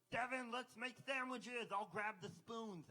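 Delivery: high, loud, slow silly voice; Special Devin voice. Acted. Alter ego waking him for sandwiches, high and silly. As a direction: sound like an eager childish inner self. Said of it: "he woke me up this morning".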